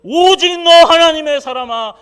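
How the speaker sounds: loud, high fervent preaching; preaching at full force. Acted. Pastor preaching fervently to the congregation. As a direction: thunder it from the pulpit.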